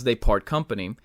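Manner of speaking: ordinary talk, nothing marked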